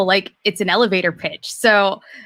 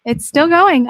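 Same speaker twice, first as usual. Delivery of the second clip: higher and louder, fed up; loud exasperated; 7 semitones higher, 7 dB louder, slower than usual. Real, not acted. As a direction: call it out in disbelief. Watching slow elevator, exasperated.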